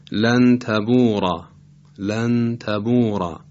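slow لن تبورا with a thick, dark ra; heavy ra. Shown as a demonstration. Tajweed teacher giving an example, heavy ra in لن تبورا. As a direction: draw out لن تبورا, final r and alef full.

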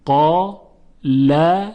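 "قا، ل" as two separate syllables with a gap; syllable-by-syllable demonstration of "قا، ل"; split syllables. Shown as a demonstration. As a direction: break it into "قا" then "ل". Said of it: "اگر این رو بخش کنیم".

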